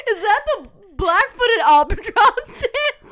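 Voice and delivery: laughing. Retold or imitated. Laughing bird courtship quote.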